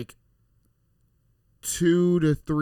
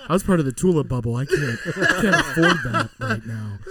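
mock despair. Mock despair over money.

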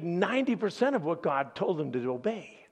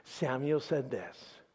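normal talking voice

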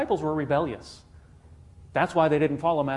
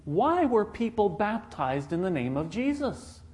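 usual speaking voice

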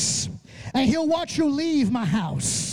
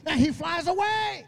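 ordinary talk, nothing marked

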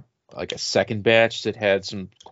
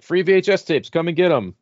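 higher, louder mock sales call; calling-out seller; 9 semitones higher, 5 dB louder than usual. Collector quoting a neighbor's giveaway post, mock hawker's call. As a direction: imitate a Facebook post hawking freebies. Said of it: "and was like".

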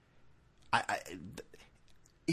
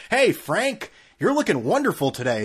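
higher, sing-song mock pleasantness; mock cheery locker room guy; 6 semitones higher, 4 dB louder than usual. Greeting a naked friend at the gym, mock cheery. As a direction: ham up a sickly-sweet gym buddy.

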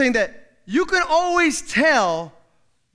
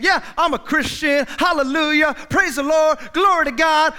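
mock showy piety; 6 semitones higher, 6 dB louder than usual.

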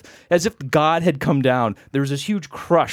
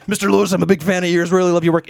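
raised, loud burst of fandom; mock gushing young admirer; imitated eager fan; 4 semitones higher, 4 dB louder, faster than usual. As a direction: blurt it out like a starstruck boy. Boy fan running up.

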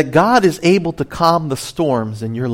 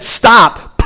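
commanding stop; 7 semitones higher, 4 dB louder than usual. Retold or imitated. Jesus commanding the storm.

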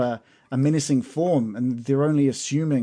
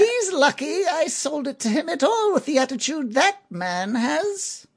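shopkeeper voice; 13 semitones higher than usual. Acted. Merchant haggling with adventurers over a pen, high put-on shopkeeper voice. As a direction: play the sly merchant squeezing a customer.

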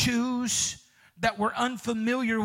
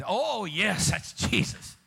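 mock delighted; 3 semitones lower, slower than usual. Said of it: "and here you".

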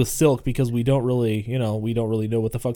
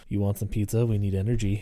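lower, softer, loaded pizza offer; suggestive offer; 4 semitones lower, 5 dB quieter than usual. Retold or imitated. Scott eyeing Robin, offering pizza suggestively. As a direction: make "pizza" mean something else. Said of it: "really, he's looking at Robin".

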